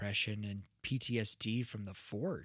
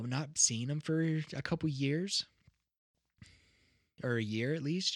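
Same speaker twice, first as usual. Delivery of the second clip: normal talking voice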